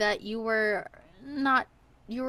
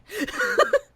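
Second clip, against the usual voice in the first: loud high burst of laughter; 8 dB louder than usual. Real, not acted. Laughing at how hard the guessing game is, high loud laugh.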